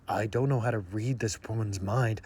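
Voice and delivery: low, soft mock brooding; Edward. Vampire puzzled by her mind, mock-brooding. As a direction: brood quietly in a put-on low voice. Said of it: "he's like".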